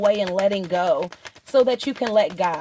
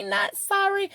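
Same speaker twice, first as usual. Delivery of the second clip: playful not sorry; 10 semitones higher than usual. Pretend. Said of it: "I'm so sorry that I was hyped".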